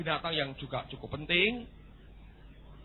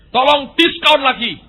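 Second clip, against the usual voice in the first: high, loud, slow begging; loud plea; 8 semitones higher, 13 dB louder, slower than usual. Acted. Dog loudly pleads with God for a discount. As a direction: beg loudly for more.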